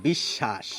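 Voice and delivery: বিশ্বাস said as bishshash, doubled sh; বিশ্বাস: bish-shash. Shown as a demonstration. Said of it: "আমরা বলি".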